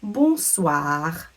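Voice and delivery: "bonsoir" drawn out with a nasal first vowel; slow, careful "bonsoir"; French pronunciation demo. Shown as a demonstration. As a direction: model French "bonsoir" clearly for learners. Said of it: "gonna need to say".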